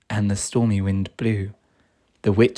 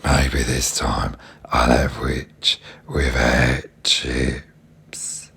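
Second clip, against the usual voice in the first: very low slow menacing dragon; 12 semitones lower, much slower than usual. A narrator's reading. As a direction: gloat low and slow, end in a whisper. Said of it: "licking his lips, and said".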